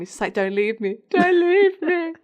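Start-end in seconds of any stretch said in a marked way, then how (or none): 1.1-2.1 high, loud, drawn-out mock wail; mock desperate plea. Imitating abandonment issues, hammed-up wailing plea. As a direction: beg like an abandoned drama queen, hammed-up. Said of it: "the abandonment issues are so strong".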